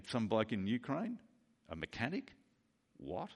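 puzzled questions said almost under the voice; quiet disbelief. Acted. Acted disbelieving listener's reaction.

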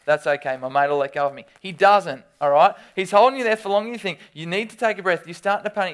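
loud, fast, building panic of being held underwater; excited storytelling. Acted. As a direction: paint the headlock scene with rising alarm. Acts out pool wrestling panic.

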